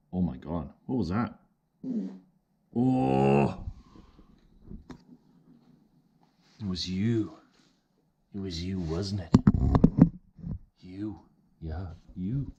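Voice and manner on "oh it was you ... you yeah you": mock-accusing a pet; playful accusing. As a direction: tease him like a suspect.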